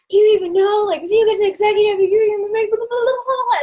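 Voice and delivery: high, loud excited babble; Skull Teddy. Retold or imitated. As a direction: gush breathlessly, spilling into blah-blahs. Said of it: "normally he's, like, so chilled out".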